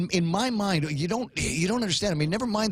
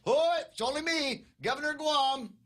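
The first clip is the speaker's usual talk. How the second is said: high sing-song "Oi, it's only me"; playful high greeting to whoever's home; imagined governor calling; 13 semitones higher, 4 dB quieter than usual. Retold or imitated. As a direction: call up the stairs cheerfully. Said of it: "He's walking up the stairs going".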